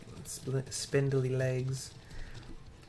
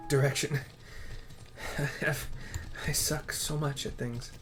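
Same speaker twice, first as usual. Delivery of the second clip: usual speaking voice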